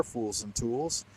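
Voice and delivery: a soft, slowed-down echo of the name; soft slow repeat. Real, not acted. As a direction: savour the name quietly and slowly. Repeating the chat name, savouring it.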